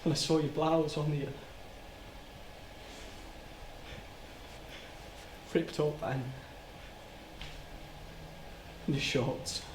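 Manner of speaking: low, soft, halting memory; reliving it in small fragments, with long pauses; quietly dreading each detail; hushed, haunted recollection. Acted. Haltingly recalls finding the victim.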